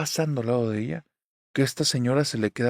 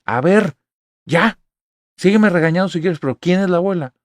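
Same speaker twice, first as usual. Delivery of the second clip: firm demand; 6 semitones higher, 7 dB louder than usual. Retold or imitated. Confronting mom over photos.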